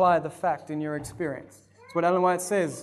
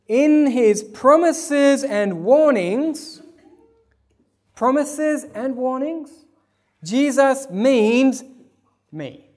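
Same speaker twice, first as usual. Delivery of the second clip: slow and higher, weighted words; preaching read; 7 semitones higher, 6 dB louder, much slower than usual. Acted. Preacher reading the quote to the church, slow and weighty.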